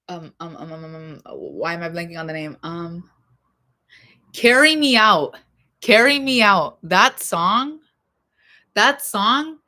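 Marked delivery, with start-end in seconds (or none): 4.3-9.6 louder, higher, blurting the title out twice; like it just popped into her head; excited outburst. Real, not acted. Remembering a [name] song for listeners, loud excited naming.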